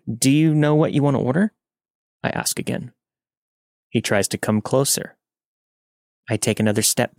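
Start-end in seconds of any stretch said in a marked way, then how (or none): 0.1-1.5 higher, polite question lifting at the end; nervously polite. A narrator's reading. Waitress asking a pushy customer for his order, nervously polite. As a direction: keep the customer-service smile despite nerves. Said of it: "When I'm nervous, I giggle".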